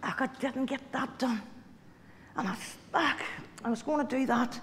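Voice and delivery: soft, grumbling ugh of regret; self-criticising mutter. Retold or imitated. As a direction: sigh it to yourself in bed. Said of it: "beat myself up stuff".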